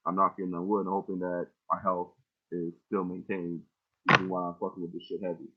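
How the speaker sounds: ordinary talk, nothing marked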